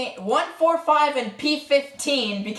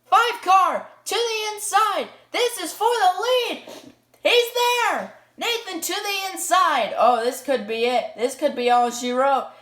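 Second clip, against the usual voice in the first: higher, driving play-by-play; motorsport commentator; 5 semitones higher than usual. Acted. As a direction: build the tension of the final lap.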